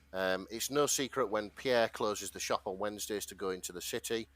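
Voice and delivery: normal talking voice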